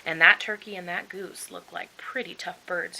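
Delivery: ordinary delivery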